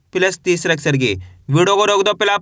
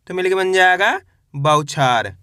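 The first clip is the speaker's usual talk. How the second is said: ordinary talk, nothing marked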